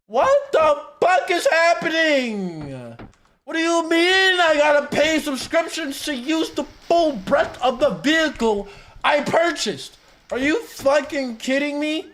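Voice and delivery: high, loud outraged yelling; furious shout. Real, not acted. Streamer yelling at the video in outrage. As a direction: blow up in disbelief at the news.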